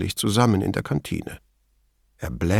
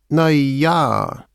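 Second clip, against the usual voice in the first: drawn-out, higher hesitant opener; 5 semitones higher than usual. A narrator's reading. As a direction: start as if hunting for words. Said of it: "begann er zögernd". (zögernd, nach Worten suchend)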